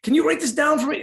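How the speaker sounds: mock needy client asking for the list; pleading clients. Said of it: "They'd always be like".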